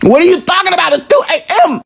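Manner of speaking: high-pitched, exasperated yell; indignant shout. Retold or imitated. Brother kept waiting confronts sister, indignant shouting. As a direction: burst out in high-pitched disbelief.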